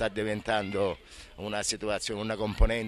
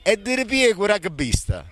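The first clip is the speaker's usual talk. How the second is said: loud, showy TV presenter; big introduction of a guest; announcer voice; 2 semitones higher, 7 dB louder, slower than usual. Acted. Reporter's loud on-air presentation.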